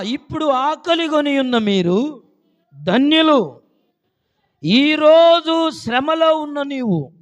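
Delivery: high, loud sermon proclamation; loud revival-style cry, pitched high; full-force preaching. Real, not acted. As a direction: preach it out to rouse the congregation.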